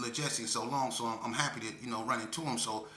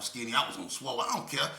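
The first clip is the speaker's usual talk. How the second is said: ordinary talk, nothing marked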